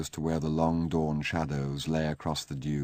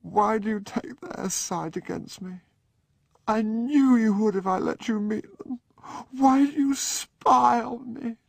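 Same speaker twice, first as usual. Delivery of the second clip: very high, tearful, slowing accusation; drunken weeping; 5 dB louder than usual. Acted. Drunk Sebastian weeping at Charles, high and tearful. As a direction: cry it out, wounded and accusing. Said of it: "Sebastian began to weep".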